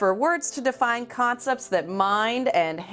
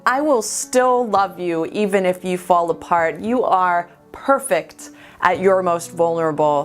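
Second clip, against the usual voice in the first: louder, insistent poetic avowal; emphatic declaration; 5 dB louder than usual. Acted. Boy's gaze tells the sobbing girl he loves her, emphatic avowal. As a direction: declare it firmly, pushing out each phrase.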